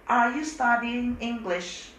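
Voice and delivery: slow, high, careful English demo; teacher says the example slowly for students; slow model sentence. Shown as a demonstration. Slow demo question.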